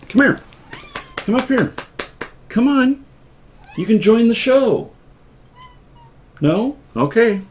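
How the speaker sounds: high sing-song voice for the cat; cat talk. Real, not acted. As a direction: coax the cat in a high sweet voice. Talking to the cat.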